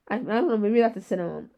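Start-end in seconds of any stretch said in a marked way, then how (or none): none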